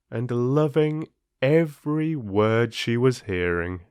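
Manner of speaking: plain reading voice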